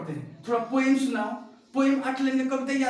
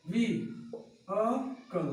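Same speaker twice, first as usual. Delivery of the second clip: Vehicle very slowly as "vee... uh... kul"; syllable by syllable; 4 dB quieter, much slower than usual. Shown as a demonstration. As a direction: break vehicle into three drawn-out parts. Teacher breaks word into syllables. (Vehicle को तोड़कर "वी... अ... कल")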